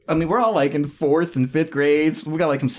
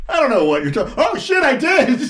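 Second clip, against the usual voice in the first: rises much higher on "oh shit, I did", slowed; driver's sudden realization; 12 semitones higher, 4 dB louder than usual. Retold or imitated. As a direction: let it dawn on him mid-sentence. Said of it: "He's like".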